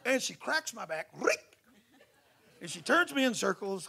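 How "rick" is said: a happy high call of his name with a laugh; hammed-up joyful hello; excited greeting. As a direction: shout it with delight. Friend greets him, lifting him.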